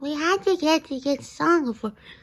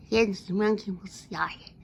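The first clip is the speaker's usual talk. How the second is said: usual speaking voice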